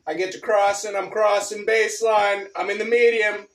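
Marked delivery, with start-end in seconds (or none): none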